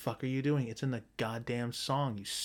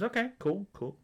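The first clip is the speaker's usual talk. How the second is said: pitched up high, easygoing okay; Dave's casual reply; 10 semitones higher than usual. Retold or imitated. Dave brushing it off, in a lightly raised high voice. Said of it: "Dave kinda says".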